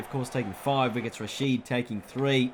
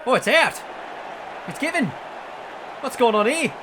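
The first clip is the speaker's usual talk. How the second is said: surprised excitement; 8 semitones higher than usual. Real, not acted. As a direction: react in real disbelief at the dismissal. Umpire gives surprise dismissal.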